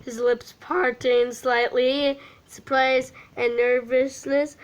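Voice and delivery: ordinary delivery, nothing marked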